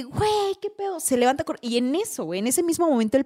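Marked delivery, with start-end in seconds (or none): 0.0-1.0 much higher startled outburst. Retold or imitated. Girl startled when the TV blasts on, with a high startled outburst. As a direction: jump at the sudden noise.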